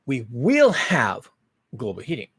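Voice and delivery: "will" slow and heavy, set against "might"; stress on will. Real, not acted. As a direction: lean hard on "We will have", then drop on "global heating".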